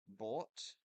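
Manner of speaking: bought with the merged law vowel; his accent's vowel. Shown as a demonstration. Showing viewers his own bought vowel. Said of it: "like court and".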